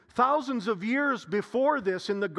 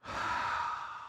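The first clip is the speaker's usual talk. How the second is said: last breath sigh; 7 dB quieter than usual. Shown as a demonstration. Acts out Jesus's final breath.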